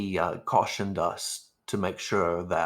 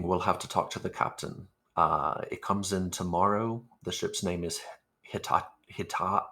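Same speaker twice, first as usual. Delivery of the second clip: usual speaking voice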